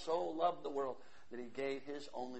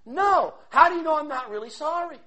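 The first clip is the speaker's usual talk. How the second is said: loud, high preacher's cry; sermon at full volume; fiery preaching; 8 semitones higher, 14 dB louder than usual. Acted. Preaching surge.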